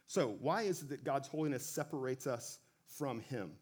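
usual speaking voice

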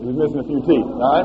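ordinary delivery

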